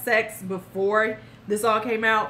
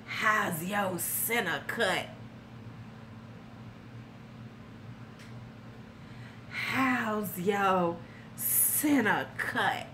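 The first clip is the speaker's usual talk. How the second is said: slow, low, creepy drawn-out murmur; sleazy mock come-on; creepy imitation; 3 semitones lower, 8 dB quieter, much slower than usual. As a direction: draw it out like a leering creep. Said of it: "This is what he calls the vagina".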